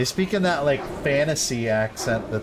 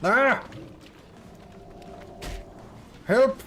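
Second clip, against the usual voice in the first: high, hammed-up yelps for help; cartoonish panicked victim voice; mock cry for help; 9 semitones higher than usual. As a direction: squeal like you're being beaten up. Mock cries of a beaten character.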